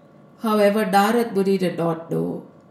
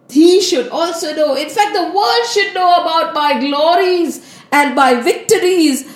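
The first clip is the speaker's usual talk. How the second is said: raised, indignant self-praise; boastful protest; 4 semitones higher than usual. Acted. Narad Muni rejects Shivji's advice, boastfully indignant. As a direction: insist that everyone must hear of your greatness. Narad insists on spreading his glory.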